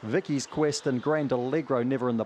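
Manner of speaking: fast live race commentary; race caller. Acted. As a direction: rattle off the field like a track caller. Calls the dog race live.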